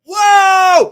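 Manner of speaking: laughing, loud startled whoa; mock startled whoa. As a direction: throw out a big laughing whoa. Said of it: "He went like this".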